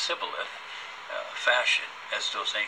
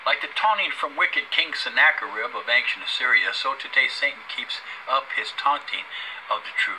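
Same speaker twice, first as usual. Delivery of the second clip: loud preaching read; 4 semitones higher, 5 dB louder than usual. Acted. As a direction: proclaim it from the pulpit. Reads scripture aloud, preaching.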